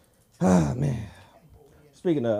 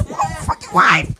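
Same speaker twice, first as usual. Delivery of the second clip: high, slow, louder; mock husband yell; 8 semitones higher, 7 dB louder, slower than usual. Comic repeats the husband's line, hammed-up high yell. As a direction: stretch the outrage out.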